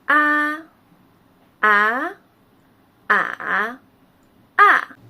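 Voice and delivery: teacher's four-tone drill on 啊; four Mandarin tones on 啊. Shown as a demonstration. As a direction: demo 啊 through all four tones, each clearly shaped. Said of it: "让我们一起读一遍".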